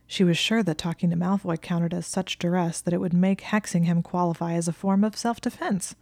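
usual speaking voice

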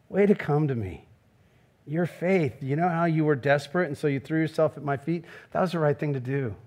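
put-on low, soft and gentle, like praising a child; tender Jesus voice. Jesus gently commends the healed woman, tender and soft. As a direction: speak it softly as a loving father.

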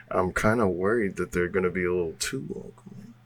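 low, hushed confiding; softened, low-pitched aside to companions; whisper. Acted. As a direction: lean in and confide a worry, softly.

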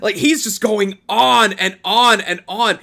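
loud, high, worked-up retelling. Real, not acted. Fan raves about a favorite dub scene, loud excited gushing. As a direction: gush at full volume, pushed up in pitch.

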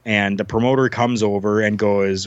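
ordinary talk

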